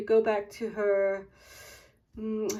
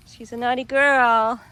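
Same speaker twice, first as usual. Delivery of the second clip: playful scolding; 2 semitones higher, 12 dB louder than usual. Real, not acted.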